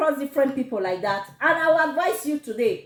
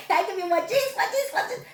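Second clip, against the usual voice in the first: much higher, laughing, teasing repeat; laughing singsong; 6 semitones higher, 3 dB quieter, faster than usual. Real, not acted. Vlogger promising viewers more gist next week, laughing singsong. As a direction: tease the audience with a giggle.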